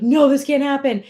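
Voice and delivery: imitating a panicked dieter; anxious panic. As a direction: blurt it out in alarm. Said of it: "you get anxious".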